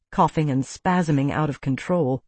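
usual speaking voice

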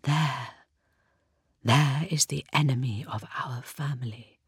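hushed, secret whisper. A narrator's reading. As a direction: whisper it barely audible. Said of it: "whispered so softly he could barely hear her".

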